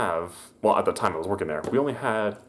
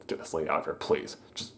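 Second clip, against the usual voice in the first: desperate whisper under the breath; softly whispered plea; 4 semitones lower, 11 dB quieter than usual. Retold or imitated. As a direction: whisper it so the customer can't hear. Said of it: "I'm just like".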